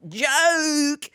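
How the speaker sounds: "Joke" held long on a high pitch; high, exaggerated mock "Joke"; mimicked glitchy pronunciation. Aping a glitching scammer's pronunciation, "Joke" blurted high and drawn out. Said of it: "the way he's glitching".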